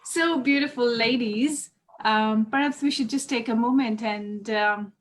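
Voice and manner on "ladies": ordinary delivery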